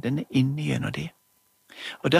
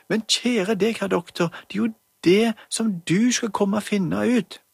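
much higher, louder pleading mum; urgent mother; 7 semitones higher, 3 dB louder than usual. Acted. Mother begs doctor to come, high and urgent. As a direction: urge the doctor to hurry. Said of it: "Det var Julie som snakket for mammen".

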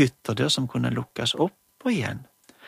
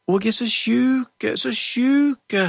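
put-on much higher, louder wailing old grandma; sick grandma voice; 10 semitones higher, 5 dB louder, slower than usual. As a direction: moan like a poorly grandma doll. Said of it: "ropte bestemor".

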